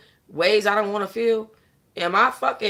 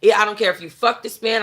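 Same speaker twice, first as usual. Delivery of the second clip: ordinary talk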